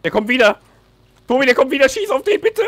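building from loud warning to a high yell; panicked gamer shouting at teammate to shoot; rising urgent shout. Real, not acted. Enemy returning, urging teammate.

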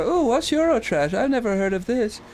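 put-on high, wide-eyed kid-like wondering; innocent curiosity. Kid at home spotting an unknown show, innocently curious. Retells own younger reaction to show.

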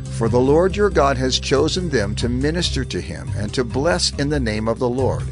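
ordinary talk

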